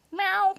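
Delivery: high playful meow for the cat. Pretend. Introducing her cat Shuba.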